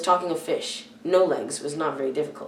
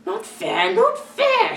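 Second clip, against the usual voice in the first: much higher, louder squeal of outrage; Gollum hissing in protest; 6 dB louder, much slower than usual. A narrator's reading. Gollum protests the pocket riddle, high outraged squeal. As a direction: shriek it like an upset creature. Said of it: "he hissed".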